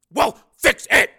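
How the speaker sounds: slow, high, loud bellow; angry yell; furious order. Acted. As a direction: shout each word as an order. Major yells order.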